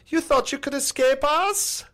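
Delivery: very high, drawn-out villain taunt; hammed-up radio taunt ending in a howl; slow, piercingly high mock menace; mock Nazi captain. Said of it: "the one captain ... would get on their radio".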